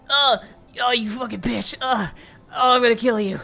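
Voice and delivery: a hammed-up betrayed hero swearing revenge; mock furious hero. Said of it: "you're like".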